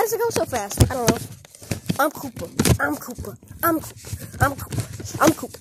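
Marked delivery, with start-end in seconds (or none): none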